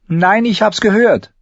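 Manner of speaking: high, loud contradiction; emphatic girlish protest; insistent. A narrator's reading. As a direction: insist against the doubters. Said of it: "beharrte sie".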